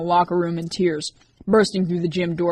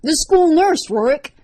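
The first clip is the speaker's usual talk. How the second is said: much higher and louder shriek, peaking on nurse; 10 semitones higher, 8 dB louder, slower than usual. A narrator's reading. Liz accusing Rorik, shrieking his name. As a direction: push the scream to breaking point. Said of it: "she screamed".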